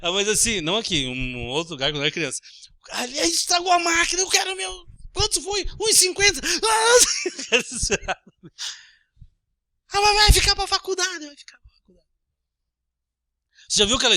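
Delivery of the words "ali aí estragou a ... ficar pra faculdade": higher whiny complaining voice, mock; mock fussing churchgoer. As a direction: whine about the broken machine. Child memory, church quarrel voice.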